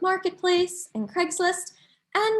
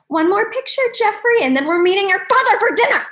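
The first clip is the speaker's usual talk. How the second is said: mock exasperated mom, raised voice; louder, rushed impatient parent; imitating upset mom; 3 semitones higher, 6 dB louder, faster than usual. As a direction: scold fast and loud as the fed-up mother. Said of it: "She was visibly upset".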